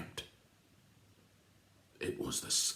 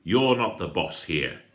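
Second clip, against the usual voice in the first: loud snarl of defiance; 8 dB louder than usual. Acted. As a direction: snarl it, standing tall. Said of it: "Patrick snarled".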